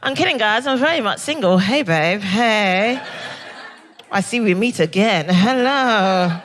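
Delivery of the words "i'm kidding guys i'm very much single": normal talking voice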